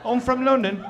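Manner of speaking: high mock London accent; put-on British voice; annoying London accent. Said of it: "It's an annoying accent".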